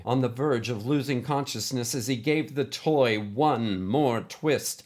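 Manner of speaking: plain reading voice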